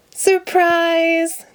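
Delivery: high shouted Surprise. Acted. Friends shouting surprise at Ernie's party, high and bright. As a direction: throw the word out high at the birthday boy. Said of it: "they all shouted".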